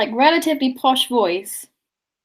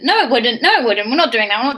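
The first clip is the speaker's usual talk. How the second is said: emphatic refusal; 2 semitones higher, 5 dB louder than usual. Real, not acted. Firmly vetoing her own idea.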